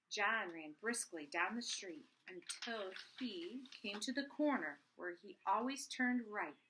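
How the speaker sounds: steady read-aloud storytelling; bedtime-book reading voice; story narration. A narrator's reading. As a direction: read the chapter to the kids. Mother reads chapter to children.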